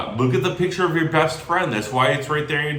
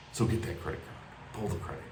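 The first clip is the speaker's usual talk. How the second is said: fading refrain; 7 semitones lower, 12 dB quieter, much faster than usual. Shown as a demonstration.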